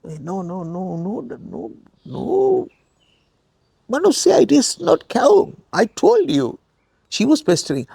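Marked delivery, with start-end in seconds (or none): 0.1-6.6 protesting husband. Retold or imitated.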